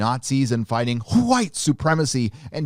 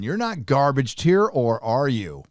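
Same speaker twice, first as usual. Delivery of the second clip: normal talking voice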